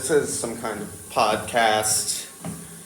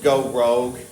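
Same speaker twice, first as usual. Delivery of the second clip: ordinary talk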